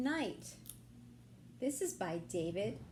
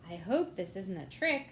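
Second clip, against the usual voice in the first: ordinary delivery, nothing marked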